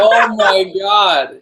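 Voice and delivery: slow, drawn-out shocked exclamation. Real, not acted. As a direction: stretch it out in disbelief. Shocked reaction.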